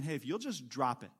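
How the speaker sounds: low, soft prompting; a quiet nudge from the Spirit. Acted. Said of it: "you hear the voice of the Spirit saying".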